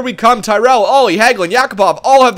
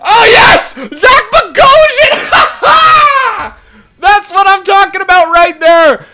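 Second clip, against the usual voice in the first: much higher, louder ecstatic shout with laughter; triumphant shout; 11 semitones higher, 5 dB louder, slower than usual. Real, not acted. Gamer screaming with joy at the overtime winner. Game-winning goal.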